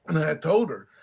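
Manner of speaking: normal talking voice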